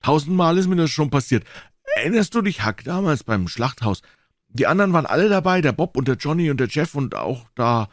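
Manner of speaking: put-on high, eager boy's voice; high-pitched excited kid; excited boy Joe, boasting. A narrator's reading. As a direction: rattle on as a boy keen to join in.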